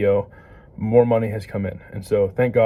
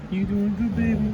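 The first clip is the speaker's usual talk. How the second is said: squeaky praising a dog; 10 semitones higher than usual. Real, not acted. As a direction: coo up high at the puppy.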